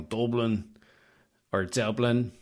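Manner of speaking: ordinary delivery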